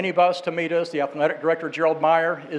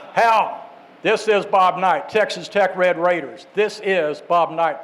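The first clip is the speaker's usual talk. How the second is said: Bobby Knight imitation; slower than usual. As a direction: announce yourself firmly as the coach. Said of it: "That's a really good imitation".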